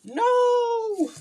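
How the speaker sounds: very high, loud drawn-out wail; hammed-up betrayed howl; dramatic scream. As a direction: scream it long and high in mock despair. Mock cry of betrayal.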